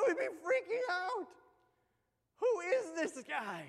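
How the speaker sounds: loud, high pretend panic; freaked out. Preacher imagines a stunned disciple, loud and high in awe. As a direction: blurt out the disbelief.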